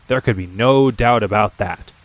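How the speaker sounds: plain reading voice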